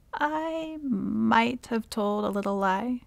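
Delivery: coy, hesitant confessing; sheepish confession. Acted. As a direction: own up reluctantly, stretching the words. Villainess sheepishly confesses a lie.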